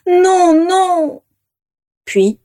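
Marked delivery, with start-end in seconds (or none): 0.1-1.2 high, louder cry of anguish; desperate moan. A narrator's reading. Vera despairs as the dragons leave her, high moaning cry. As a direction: break into a pained wail. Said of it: "gémit Vera".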